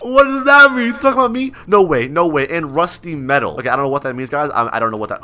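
ordinary delivery

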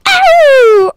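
long, high, loud "ow"; pained yelp. Real, not acted. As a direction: howl as if suddenly hurt. Game character gets hurt.

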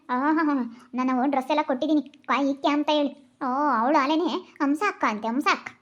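ordinary delivery

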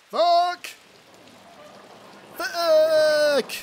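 high, sharp startled swearing; startled curse. Real, not acted. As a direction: yelp the curse. Startled in game.

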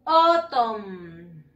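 "Autumn" drawn out as OOH-tommm, falling; pronunciation model. Shown as a demonstration. Teacher has pupils repeat after her, "Autumn" as slow OH-tom. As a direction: stretch "Autumn" from a long o down to a held m. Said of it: "Repeat after me".

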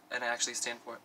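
ordinary talk

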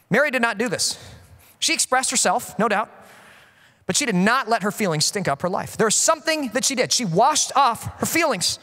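ordinary delivery

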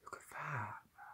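hushed, awed whisper. Real, not acted. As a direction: whisper it in awe. Close-up admiring the figure.

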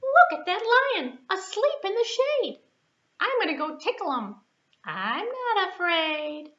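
a tiny mouse daring herself, up high; squeaky little mouse. Acted. Puppet mouse sneaking up on the sleeping lion, high and bold.

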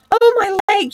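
high, loud yelp of pain; pained squeal. A narrator's reading. Mole falls on his face in the snow, squealing. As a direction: cry out sharply, voice jumping up. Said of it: "fell forward on his face with a squeal".

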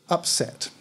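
ordinary delivery, nothing marked

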